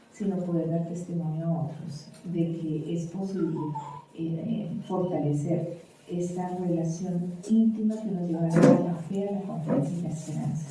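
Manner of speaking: soft, reverent closing prayer. Acted. Pastor praying for parents at close, soft and reverent. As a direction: pray quietly and humbly to the Lord.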